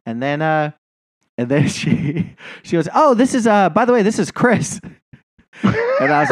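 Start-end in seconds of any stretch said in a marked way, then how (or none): 2.9-4.8 high, chirpy imitation of the girl; higher, louder girlish put-on voice; mock breezy introduction. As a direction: toss it off up high like it's nothing.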